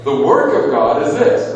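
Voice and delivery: loud, declaring; big pulpit proclamation; proclaiming. Acted. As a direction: announce it boldly. Jesus's answer, delivered loudly.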